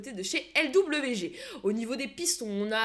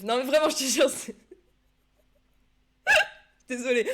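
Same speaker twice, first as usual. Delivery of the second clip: laughing; 4 semitones higher, 8 dB louder than usual. Real, not acted. Laughing fit on live stream.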